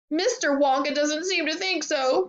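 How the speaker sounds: high, indignant outburst; upset high-pitched mother; crying out. A narrator's reading. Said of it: "cried Missus Globe".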